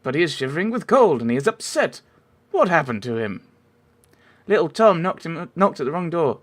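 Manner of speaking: put-on ladylike voice, well above own pitch; lady's accent. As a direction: introduce yourself as a genteel neighbour. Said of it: "I keep changing your accent".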